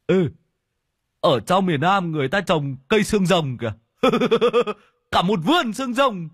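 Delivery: high, laughing exclaim; tickled by a field of cactus. A narrator's reading. Lâm Sẹo laughs pointing at a cactus garden, high laughing voice.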